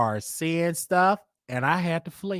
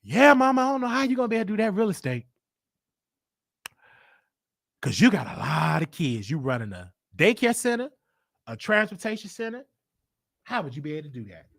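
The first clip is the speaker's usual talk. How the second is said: fast gentle challenge; 2 semitones higher, faster than usual. Retold or imitated. Morning talk with mom.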